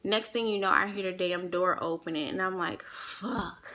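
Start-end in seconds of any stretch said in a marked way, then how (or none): 2.8-3.7 soft, breathed-out swear of dread; quiet retold "oh no" moment; hushed curse. Retold dread as door opens.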